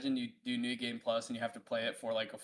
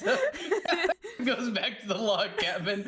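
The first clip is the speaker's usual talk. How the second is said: giggling through the words; words broken by laughter; laughing; 13 semitones higher, 7 dB louder, much slower than usual. Real, not acted.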